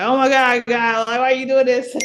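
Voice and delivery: high, loud retold plea to God; mock crying out. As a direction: cry out to God, replayed half in jest. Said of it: "crying out".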